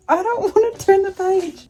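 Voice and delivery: high, crying-edged whine; begging not to go on; tearful pleading. Real, not acted. Vlogger refusing to turn the page, tearful whine. Dreading the heartbreaking ending.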